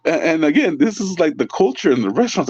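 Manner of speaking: high voice recovering from laughter; giggly, high-pitched explanation; still laughing. Real, not acted. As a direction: talk while getting over a laughing fit.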